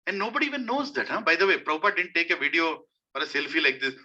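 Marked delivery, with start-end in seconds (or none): none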